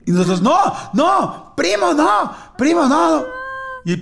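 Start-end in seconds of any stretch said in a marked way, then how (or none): 0.4-3.3 put-on kids begging, pitched way up; high panicked pleading. Children in the van beg their carsick cousin: high panicked pleading. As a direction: plead high and urgent, almost squealing.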